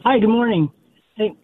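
normal talking voice

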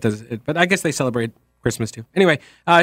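normal talking voice

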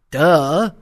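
loud, emphatic "duh"; big self-mocking jab. Real, not acted. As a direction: blurt it out, mocking your own foolishness. Mocks her own past blindness.

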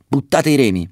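high, urgent command; shouted order. A narrator's reading. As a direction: call the order out to the crew. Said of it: "gli urlò il suo migliore amico". (ordine gridato, acuto)

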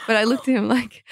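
ordinary talk

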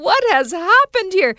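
aghast bride, high and drawn out; slow, high-pitched mock horror. Bride at the head table reacting, aghast. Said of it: "the bride is aghast at the head table going".

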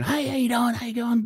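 high, soft singsong hello; put-on party greeting. Husband acting out greetings at a party, put-on sociable. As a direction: act out working the room with hellos.

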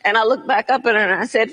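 ordinary talk

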